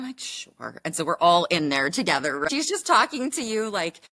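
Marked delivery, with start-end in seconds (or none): 0.2-0.8 much softer, lower resigned sure; meek agreement. Retold or imitated. Patient reluctantly agreeing to the student, meek and soft. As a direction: barely voice your consent.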